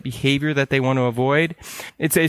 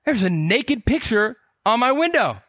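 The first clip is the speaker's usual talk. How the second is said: much higher, alarmed report to a buddy; retold alarm; 9 semitones higher than usual. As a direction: blurt it out like a rattled guy. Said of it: "I'm like".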